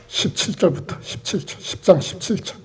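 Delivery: unvoiced aside while finding the verse; muttering to self. Real, not acted. Pastor finding the verse in his Bible, under his breath. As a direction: whisper the reference to yourself.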